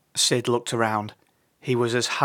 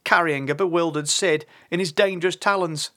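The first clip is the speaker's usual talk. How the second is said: plain reading voice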